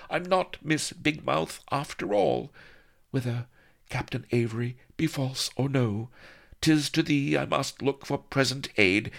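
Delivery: put-on high, haughty young lady in olde speech; high archaic noblewoman asking for help; Vanity's voice. A narrator's reading. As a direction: play the imperious heroine, pitched high.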